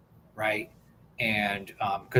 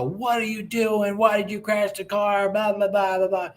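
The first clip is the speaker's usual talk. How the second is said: high, sing-out parody of a screaming parent; hammed-up scolding trailing into blahs; mock ballistic rant; 7 semitones higher than usual. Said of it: "go ballistic and start screaming at him".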